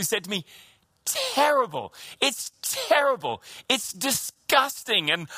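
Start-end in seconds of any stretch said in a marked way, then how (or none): 1.1-5.1 high, drawn-out, disgusted verdict, slowing down; imitating mum groaning about a filthy house; mock disgust. Mother and sister tell him the house is terrible, in high slow mock disgust.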